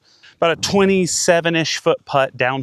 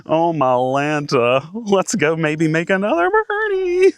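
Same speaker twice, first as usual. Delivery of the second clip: slow, much higher, giddy with delight; excited; 5 semitones higher, slower than usual. Real, not acted. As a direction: savor the thrill, drawn out and high. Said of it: "I'm getting too excited".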